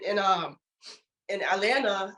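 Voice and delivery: ordinary delivery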